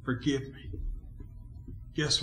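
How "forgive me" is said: humble, hushed mock confession; acted contrite prayer. Acts out a sorry prayer.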